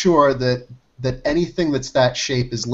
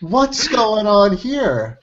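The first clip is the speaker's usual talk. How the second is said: bewildered; 6 semitones higher, much slower than usual. Real, not acted. Bewildered at uncanny picture.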